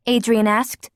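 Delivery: ordinary delivery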